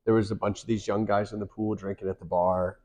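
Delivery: ordinary delivery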